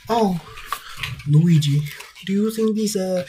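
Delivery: high, loud attempt at Mario's accent; badly faked Mario accent. Reviewer quoting Mario asking Luigi, failed high Mario accent. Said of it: "Look, I can't do the accent".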